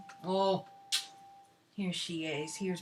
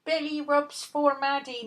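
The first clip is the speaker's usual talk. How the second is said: slower, high drawn-out croon; sing-song coo; 6 semitones higher, 3 dB louder, slower than usual. Real, not acted. Owner crooning to the dog, slow and high.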